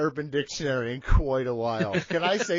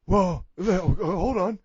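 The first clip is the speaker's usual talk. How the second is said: Big Johnny voice. Acted. Big Johnny dodges the host's question, evasive character voice. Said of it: "but Big Johnny".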